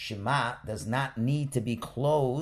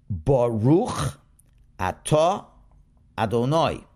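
louder, slow liturgical reading, each word apart; slow careful reading; 5 dB louder than usual. Shown as a demonstration. Teacher modeling the blessing for students, slow and clear. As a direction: read 'Baruch atah Adonai' slowly, word by word. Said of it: "read this page slowly and carefully".

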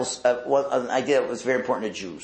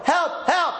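much higher, louder mock cry; desperate cry for help; 4 dB louder than usual. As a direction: call out in panic to God.

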